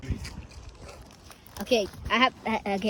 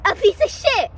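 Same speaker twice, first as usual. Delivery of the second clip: loud punchline; 10 semitones higher, 7 dB louder than usual. Acted. Punchline of his joke.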